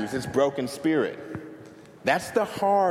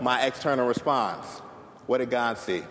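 ordinary talk

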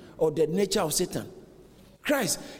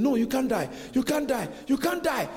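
insistent high-pitched objection, rising as it repeats; high, emphatic refusal, played out; acted protest; 7 semitones higher than usual. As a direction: protest harder with each line, climbing in pitch. Acts Peter refusing Jesus' death.

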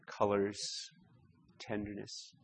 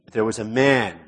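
"man" pressed hard and louder; emphasis on man; 8 dB louder than usual. Shown as a demonstration. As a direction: lean into "man" so it stands out. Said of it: "and man, the father, is emphatic".

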